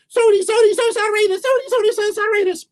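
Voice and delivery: very high, rapid squirrel chant; squeaky high-speed singsong refrain; fast squirrel. Shown as a demonstration. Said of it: "Gotta say it real fast, like a squirrel".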